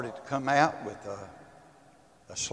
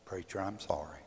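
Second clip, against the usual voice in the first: soft, low retold apology; subdued apology; 6 semitones lower, 13 dB quieter than usual. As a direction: say sorry quietly, backing down. Retold apology after a quarrel.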